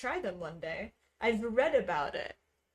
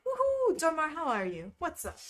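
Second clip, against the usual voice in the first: high happy woohoo; excited cheer; 7 semitones higher than usual. Real, not acted. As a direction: cheer for a friend arriving. Cheering a viewer.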